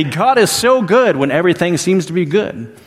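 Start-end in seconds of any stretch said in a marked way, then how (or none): 0.0-1.2 higher-pitched, emphatic praise of God; emphatic declaration. Retold or imitated. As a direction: declare "God is so good" with raised pitch and conviction. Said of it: "we will emphatically say".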